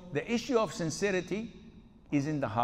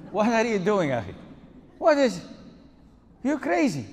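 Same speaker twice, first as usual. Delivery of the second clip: high, loud, incredulous; shocked outburst; 6 semitones higher, 8 dB louder than usual. Real, not acted. The sheikh reacts to the worried man with a loud, shocked outburst. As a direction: blurt it out in disbelief.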